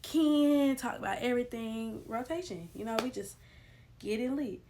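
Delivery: ordinary delivery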